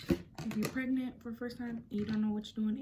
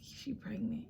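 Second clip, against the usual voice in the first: whispered; 6 dB quieter than usual. Retold or imitated. Boyfriend absorbing the news.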